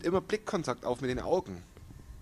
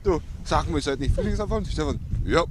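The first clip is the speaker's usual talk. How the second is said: stifled giggles; 4 semitones higher, 6 dB louder than usual. Real, not acted. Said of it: "Hör auf zu lachen".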